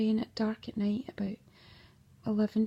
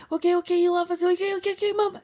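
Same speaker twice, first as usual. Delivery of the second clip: high, loud imitation of children; exasperated children's voice; 8 semitones higher, 7 dB louder, faster than usual. Children telling mum they get it, high and loud. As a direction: pipe up loudly like children giving in.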